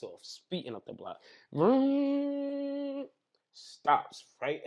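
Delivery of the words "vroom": high, loud revving vroom; imitated car engine roar. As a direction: rev like a car tearing up the block.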